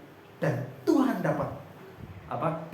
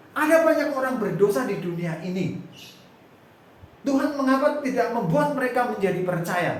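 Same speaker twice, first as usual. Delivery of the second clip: higher, louder impassioned exhortation; preacher urging the congregation, higher and louder; fervent preaching; 8 semitones higher, 4 dB louder than usual. Acted. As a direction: preach it with rising fire.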